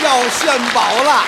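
slow, loud build-up; drawn-out fanfare before the reveal; showman's announcement. Pretend. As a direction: call it out to the whole audience.

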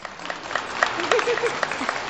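laughing. Real, not acted. 听完上海吆喝笑.

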